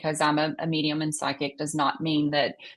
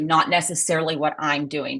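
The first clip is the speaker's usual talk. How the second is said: ordinary talk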